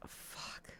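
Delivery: breathy whisper, voice dropped to nothing; whispered curse. Retold or imitated. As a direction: mutter it under your breath in dread. Dreading the vet's news.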